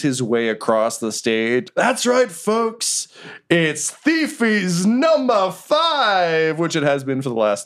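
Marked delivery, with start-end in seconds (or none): 1.8-6.6 high, drawn-out game show host voice; milk the big announcement like a cheesy MC; hammy award show host; showman host. Acted.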